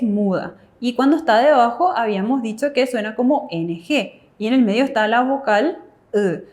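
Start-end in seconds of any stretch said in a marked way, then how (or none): none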